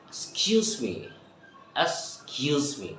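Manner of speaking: "Excuse me" shrunk to a soft "skyooz me"; native fast-speech reduction. Shown as a demonstration. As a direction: leave off the "ex" of "Excuse me". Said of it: "এক্সটাকে আমরা আর বলবো না". ("Excuse me"-কে নরম করে "স্কিউজ মি")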